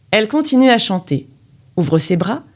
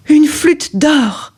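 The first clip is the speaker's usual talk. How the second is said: amazed discovery; 7 semitones higher, 3 dB louder, much slower than usual. A narrator's reading. Discovery of golden flute.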